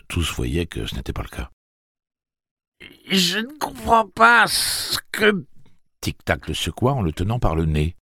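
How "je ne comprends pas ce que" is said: high, slow nasal voice; pinched and scared; nasal frightened boy. A narrator's reading. Jake held by the nose, nasal and scared.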